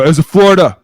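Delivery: high, very loud blurted answer; panicked freshman. Retold or imitated. Freshman answers the angry coach, panicked yell. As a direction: yelp it back at the coach. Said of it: "And I go".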